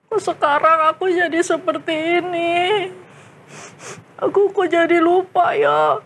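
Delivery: remorseful, voice wavering; tearful regret. Acted. Boy realising he forgot his faith, tearful. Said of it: "Nangis dia".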